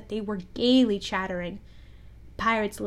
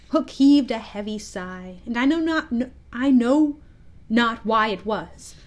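ordinary delivery, nothing marked

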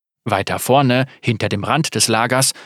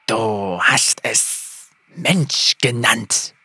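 slow, lisping hiss of a lizard-man; lisping reptile voice; much slower than usual. A narrator's reading. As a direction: let the forked tongue flick through each word. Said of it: "mit einem seltsamen Lispeln".

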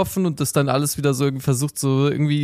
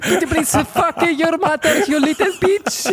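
much higher, laughing squeaky voice; 14 semitones higher than usual. Pretend. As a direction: falsetto mock insult through laughter. Laughing falsetto mock insult.

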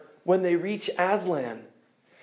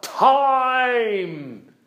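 calling out; 3 semitones higher than usual. Acted. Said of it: "and calls out".

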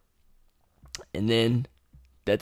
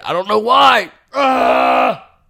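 high, loud exasperated cry; loud high groan of frustration; frustrated outburst; 10 semitones higher, 8 dB louder, much slower than usual. Real, not acted. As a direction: vent the annoyance out loud. Channel owner deleted audio.

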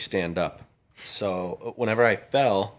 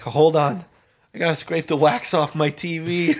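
laughing; 7 semitones higher, 6 dB louder than usual. Retold or imitated. Retold own absurd priority, laughing.

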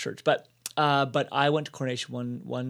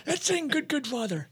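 put-on high, creaky-old-timer caricature; hammed-up elderly voice, pitched way up; ninety-two-year-old man; 14 semitones higher than usual. Jokingly playing himself at ninety-two onstage, old man voice. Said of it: "ninety-two-year-old [name] walking around with his cane".

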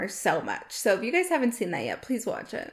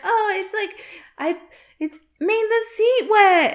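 put-on high childlike daughter voice; 12 semitones higher, 8 dB louder than usual. Mother quoting her daughter about the wet seat, high childlike voice. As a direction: mimic the daughter, high and whiny. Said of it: "And she's like".

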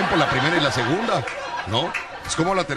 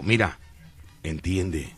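coaxing the card; 4 semitones lower, slower than usual. Pretend. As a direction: gently persuade a scared card. Talking to the card tenderly.

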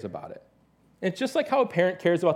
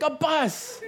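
put-on much higher, gleeful toddler cry, half laughing; excited toddler; 11 semitones higher than usual. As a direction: shout it like a thrilled little kid spotting a plane. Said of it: "It's like".

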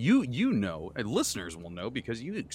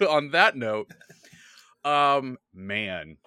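normal talking voice

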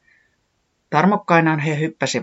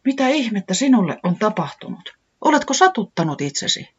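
worried; 8 semitones higher than usual. A narrator's reading. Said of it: "kysyi huolestuneena".

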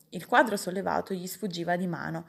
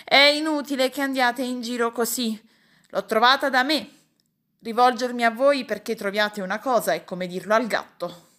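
raised, higher voice of a vexed uncle; loud, huffy scolding; indignant shouting; 4 semitones higher, 5 dB louder than usual. A narrator's reading. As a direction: cry out in exasperation at useless helpers. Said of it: "e allora gridava".

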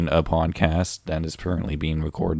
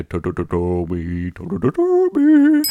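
calling the cat; 6 dB louder than usual. Acted. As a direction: call Toby in a stammering squeal.

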